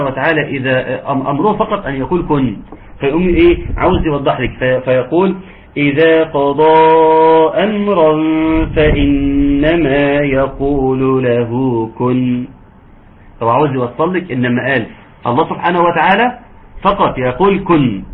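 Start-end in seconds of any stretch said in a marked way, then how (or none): 5.8-12.5 slow drawn-out tajweed chant; Quran recitation. Shown as a demonstration. Teacher reciting the verse to students, slow tajweed chant. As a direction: recite it slowly, stretching the vowels.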